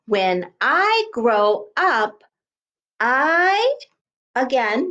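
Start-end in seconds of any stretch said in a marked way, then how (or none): 0.1-3.8 slow read-back, word by word; slow dictation. Shown as a demonstration. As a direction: stretch each word for young writers. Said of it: "we know how to write that".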